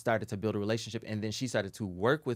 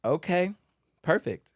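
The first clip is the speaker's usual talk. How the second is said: acted compliance; 8 semitones higher, 6 dB louder, slower than usual. Acts out accepting the harsh note.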